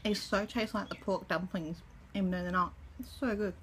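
eating between words; talking while chewing. Real, not acted. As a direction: mouth partly full of food. Seen 3: Lips pursed and working between words; bitten dumpling pieces held near mouth.

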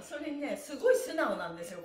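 ordinary talk, nothing marked

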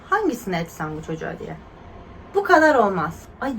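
ordinary talk

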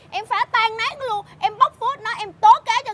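heated, rising rant; angry outburst. Acted. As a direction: storm in to expose the scammer. Tức giận tố cáo kẻ gian. (giận dữ quát tháo)

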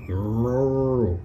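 usual speaking voice

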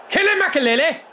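urgent high yell at the screen; yelling Kill him at his player, loud and high; excited yell. Real, not acted. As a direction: roar him into the tackle. Yelling at game player to tackle.

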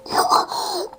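high breathy whimper; a frustrated half-sob; whimpering cry. Real, not acted. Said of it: "ağlayacağım lan".

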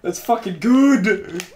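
slow, drawn-out and sad; dramatic slow delivery. Shown as a demonstration. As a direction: say it slowly with a sad weight. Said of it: "That actually sounded really sad".

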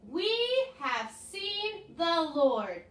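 put-on each word drawn out for children to repeat; slow call-and-response line. Shown as a demonstration. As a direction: say it slowly so the kids can follow. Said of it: "I need you guys to say that".

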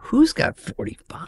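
soft, slow, stunned question; mock dismay. Comic reacting to a college asking for forty-five minutes, soft and slow. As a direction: gasp it out in disbelief. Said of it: "I'm like".

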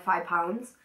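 ordinary talk, nothing marked

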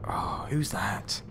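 slow, hushed question; soft, drawn-out whispered alarm; wary whisper. Real, not acted. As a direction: whisper it slowly on spotting someone. Spots someone in the game.

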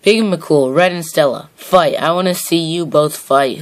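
ordinary talk

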